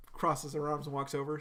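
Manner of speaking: ordinary talk, nothing marked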